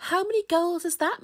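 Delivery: put-on high, loud, quick young spider; excited little one's voice; eager child's question. A narrator's reading. Small spider asking Mum eagerly.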